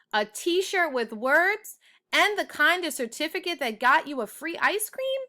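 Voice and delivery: high, delighted disbelief; like a kid who can't believe the prize; thrilled kids hearing about free ice cream; excited. Acted.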